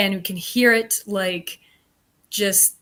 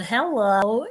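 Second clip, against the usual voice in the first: little sing-song hello; 2 semitones higher, 3 dB louder than usual. Retold or imitated. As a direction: lilt the hello playfully. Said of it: "in this little sing-song voice".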